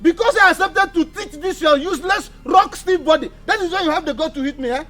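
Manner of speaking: high-pitched heated outburst; indignant shouting. Acted. Teacher insulted by a useless pupil, indignant shouting. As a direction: rail at them in outrage.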